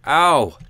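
sudden pained yelp "ow"; sharp yelp. Real, not acted. Gamer yelps at taking damage in game, sharp cry. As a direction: yelp as the character takes a hit.

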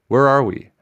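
usual speaking voice

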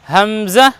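হামজা said ham-za, with a buzzed z; buzzing z sound. Shown as a demonstration. Teacher corrects the j version, হামজা with z. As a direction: put a clear z at the end of হামজা. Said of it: "জা বর্ণ লাগাবো".

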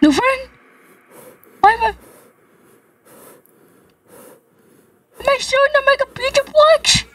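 high shaky whimpering voice; lingering fright. Real, not acted. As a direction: stay small and spooked. Still frightened, voice kept high.

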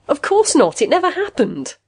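high, loud exasperated rebuttal; indignant denial. A narrator's reading. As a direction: deny it hotly, pitched well up. Patient angrily denies machine's theory.

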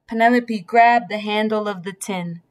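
ordinary delivery

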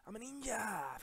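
high, loud playful boast; boastful battle cry. Pretend. Gamer escaping monsters, high loud ninja boast. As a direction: yell it like a cartoon fighter.

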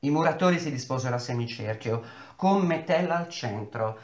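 ordinary delivery

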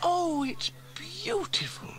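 high, slow breath of wonder; awed, drawn-out admiration; Sarah gasping. A narrator's reading. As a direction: gasp it out in a child's high voice. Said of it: "gasped Sarah".

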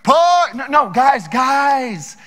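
high, loud mock panic; panicky pleading. Theudas begging his followers to stay, mock frantic pleading. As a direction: beg the crowd not to leave, hammed-up. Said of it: "He's like".

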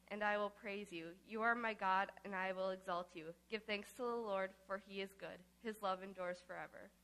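normal talking voice

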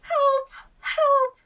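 very high, loud yelling; desperate cry for help. A narrator's reading. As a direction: scream for help in alarm. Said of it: "cried the court".

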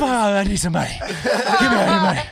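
high, loud mock-frantic demand with laughter; retold panic. Scared friend begging his buddies for money, comically frantic. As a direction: beg loudly, half laughing at yourself. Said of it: "Sweat, so scared".

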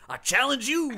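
high, loud mock challenge. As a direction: call out a rival, hammed up. Dorm mate challenges for title.

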